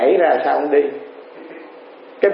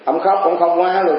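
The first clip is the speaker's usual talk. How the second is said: ordinary delivery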